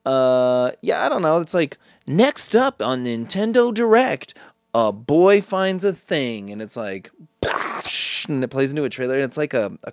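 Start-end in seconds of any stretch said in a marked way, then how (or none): 2.1-6.5 announcer voice. Retold or imitated. Parodying the presenter's announcement.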